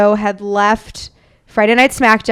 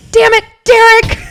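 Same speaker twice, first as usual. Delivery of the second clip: high, loud mock-scolding mom; imitating mom snapping at her son, pitched way up and loud; exasperated mom; 12 semitones higher, 7 dB louder than usual. Mom voiced scolding brother in story.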